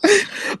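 short squeaky burst of laughter; quick high yelp of a laugh. Real, not acted. Caught off guard, he yelps out a laugh.

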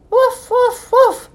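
three high-pitched woofs; eager dog bark. A narrator's reading. Ebb spotting a lone head at sea, barking. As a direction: bark to be noticed across the water. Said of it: "barked as loud as he could".

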